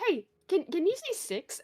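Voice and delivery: high, playful "can you say six?"; teasing request. Retold or imitated. Teasing a New Zealander into saying "six", high and playful. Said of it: "that's a favorite of just".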